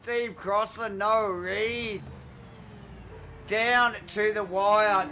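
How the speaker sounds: loud, high amazed call; excited commentary. Real, not acted. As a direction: marvel at the finish. Post-finish excited commentary.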